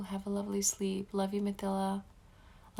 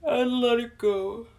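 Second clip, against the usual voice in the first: guided meditation; 6 dB louder than usual. Acted. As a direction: guide them gently into calm. Leads a breathing meditation.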